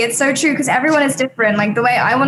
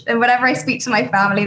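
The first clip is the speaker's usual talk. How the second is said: usual speaking voice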